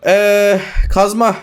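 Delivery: ordinary delivery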